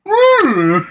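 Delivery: high, loud whoop; mock whoop. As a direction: whoop it out like you're impressed. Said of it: "And he goes".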